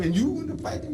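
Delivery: put-on can't believe the boy; mock incredulity; incredulous commander. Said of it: "So what?".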